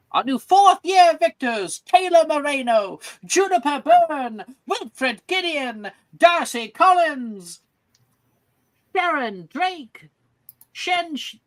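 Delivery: high, ringing roll-call of champions; drawn-out, high ceremonial announcing; grand announcer. Acted. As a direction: proclaim each name to the crowd. Announces victors by name.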